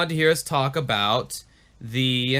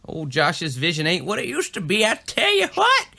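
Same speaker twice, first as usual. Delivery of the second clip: put-on old-timer voice; 3 semitones higher than usual. Folksy self-mockery.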